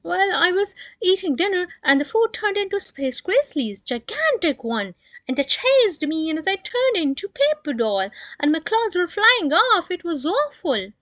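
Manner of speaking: high, loud, breathless recount; frightened child, loud and high; upset boy. Acted. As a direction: tell the scary dream in a rush. Brother retells nightmare upset.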